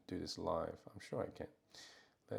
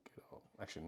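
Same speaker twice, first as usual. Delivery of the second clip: whispering; 14 dB quieter, much faster than usual. Real, not acted. Muttering to himself while working.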